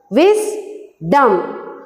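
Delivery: "Wisdom" in two beats, a clear pause after Wis; split into syllables. Shown as a demonstration. Teacher showing the class two syllables, "Wisdom" split Wis-dom. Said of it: "We can divide these words into two".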